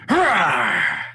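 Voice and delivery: playful war cry, loud; mock battle cry. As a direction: leap out at them with a yell. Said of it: "turns around and goes".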